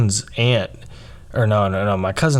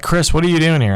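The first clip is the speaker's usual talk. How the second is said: higher, louder mock coach surprise; surprised coach; 8 semitones higher, 5 dB louder, faster than usual. Coach surprised to see a cut player show up, higher and louder. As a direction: toss it out like a startled coach. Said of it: "he goes".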